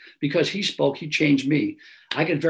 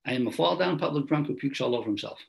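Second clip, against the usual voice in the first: usual speaking voice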